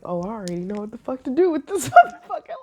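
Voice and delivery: fast, loud, eager dark inner voice with a laugh; dark shadow self. Pretend. Her dark shadow self itching to fight, loud and gleeful. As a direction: let the menacing shadow self take over, gleeful. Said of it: "my shadow's like".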